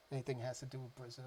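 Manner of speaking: ordinary talk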